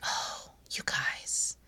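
soft unvoiced whisper. Shown as a demonstration. Mom whispering lovingly to her little kids. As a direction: breathe it out to the kids. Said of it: "Whispering helps".

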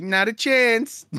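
high, drawn-out mock denial. Singer denying paternity, high mocking imitation. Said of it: "He's like".

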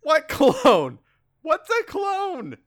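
high, incredulous mock indignation; baffled outburst. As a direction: blurt each question in comic disbelief. Acted baffled indignation in comic quarrel.

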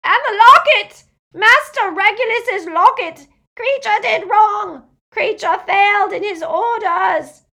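very high, very loud hysterical elf; blood-curdling scream. A narrator's reading. Elf confesses his failure, screaming. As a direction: scream it in anguished self-blame. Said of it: "he uttered a blood-curdling scream".

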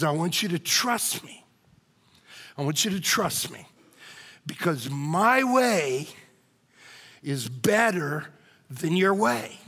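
gentle voice of God. Retold or imitated. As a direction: reassure gently, like a patient father. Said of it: "what he wants to say to us".

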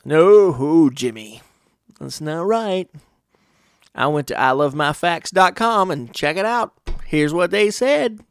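emphatic, hammed-up correction; mock know-it-all. Adult stepping on Jimmy's toes, mock know-it-all correcting. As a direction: push it out like an insistent fact-checker.

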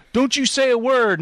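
hammed-up warning; threatening. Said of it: "you can threaten him".

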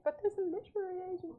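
high-pitched, acted-out eager protest; mock excited plea, voice shooting up. Author replays pleading with upset husband about the agent, excited protest. Retold plea after bad news.

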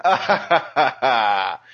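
knowing laugh of recognition; group laugh. Retold or imitated. Laughing with the grown-ups at an old reference. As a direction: laugh along like you got the old joke. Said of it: "all went".

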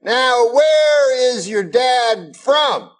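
high, slow put-on old comic voice; Bill Cosby impression. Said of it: "And he goes".

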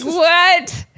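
high, loud mock-disbelieving "what"; incredulous. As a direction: blurt it out in disbelief. Laughing in people's faces, incredulous.

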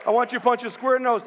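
worked-up dad egging on his son, rushed; excited retold dad. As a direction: re-enact a dad getting carried away, quick and high. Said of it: "as I'm getting all excited".

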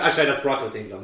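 normal talking voice

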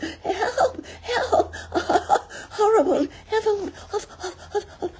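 high, panting panicked squeals; Piglet crying out. A narrator's reading. Piglet scampering off as hard as he could, crying out breathless. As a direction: gasp and shriek as if running for your life. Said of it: "crying out".

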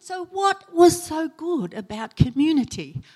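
asking the brother what was so good; eager question. Retold or imitated. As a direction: lean in, keen to know.

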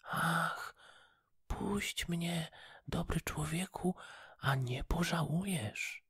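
high, soft little fish voice begging; tiny pleading voice. Acted. Caught goldfish begs the fisherman to free her, high and pleading. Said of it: "głosik, cieniutki i błagalny".

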